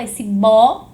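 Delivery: bó hit loud and drawn out; "bó" as the heavy stressed syllable. Shown as a demonstration. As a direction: punch out a long, strong "bó". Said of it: "mais forte".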